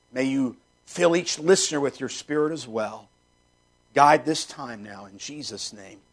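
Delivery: hushed sincere prayer asking for guidance. Real, not acted. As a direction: pray quietly to the Father. Closing prayer over the lesson.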